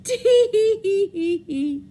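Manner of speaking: high, slow monkey giggle; teasing monkeys. Acted. Monkeys mocking the peddler with high slow giggles. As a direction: tease him like cheeky monkeys. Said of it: "the monkeys ... said".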